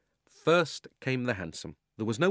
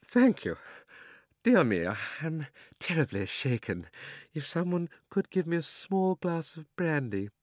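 put-on shaken old gentleman; 6 semitones higher than usual. A narrator's reading. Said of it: "in a faint voice".

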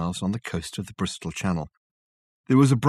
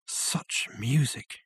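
slow, soft, hushed wonder; awed; 8 dB quieter, much slower than usual. Acted. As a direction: breathe it in awe. Richard awed by moonlit grass.

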